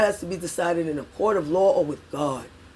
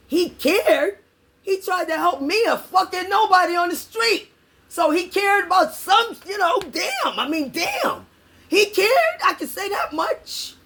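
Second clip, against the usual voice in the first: much higher, insistent praise; heated, higher-pitched insistence; passionate; 9 semitones higher than usual. Real, not acted. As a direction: plead his point, voice way up. Emotional praise of Clinton.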